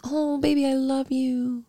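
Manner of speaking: high, soft mock-sweet lover; classic guy. Mocking a man trying to win his ex back, high and soft. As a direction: coo it as a mocking imitation, drawn out. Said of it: "like, classic guy".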